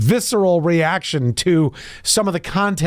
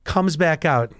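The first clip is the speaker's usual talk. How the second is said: ordinary delivery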